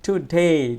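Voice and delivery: "Today" with a loud, stretched ডে; stress on ডে. Shown as a demonstration. Teacher showing students the stress, loud long ডে. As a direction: punch the second syllable of "today". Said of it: "জোরে বলতে হয়েছে".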